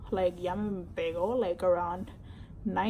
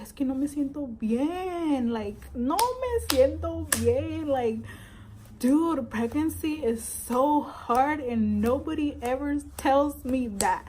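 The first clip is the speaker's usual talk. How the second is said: higher, slower, tearful plea; tearful complaint; 4 semitones higher, slower than usual. Retold or imitated. Telling mom at work, tearful higher plea. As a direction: whine it through tears.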